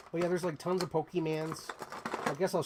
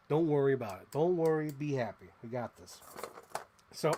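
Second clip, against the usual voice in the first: ordinary talk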